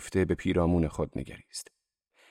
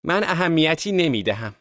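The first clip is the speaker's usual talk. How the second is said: high, loud cry of defiance; raised, high-pitched exclamation; shouting; 10 semitones higher, 5 dB louder than usual. A narrator's reading. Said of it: "دیزی فریاد زد".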